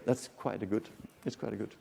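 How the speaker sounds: usual speaking voice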